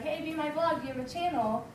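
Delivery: eager, bright invitation; excited invite. Retold or imitated. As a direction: pitch it like a friendly vlogger. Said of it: "he's like".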